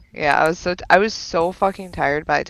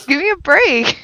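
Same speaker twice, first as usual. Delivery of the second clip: exasperated quip; 10 semitones higher, 5 dB louder than usual. Retold or imitated. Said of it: "And I'm like".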